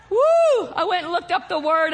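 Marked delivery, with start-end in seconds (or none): 0.1-0.7 high, loud "woo"; excited whoop. Real, not acted. Preacher thrilled by Twain's line, excited whoop. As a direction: let out a whoop.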